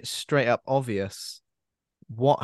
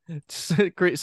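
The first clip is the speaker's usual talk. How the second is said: laughing; 6 semitones higher than usual. Real, not acted. Laughing at the film's mess.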